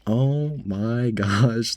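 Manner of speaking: long drawn-out, slow astonishment; amazed disbelief. Retold or imitated. Cashier hearing the customer demand a manager, amazed. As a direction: stretch it out in mock awe.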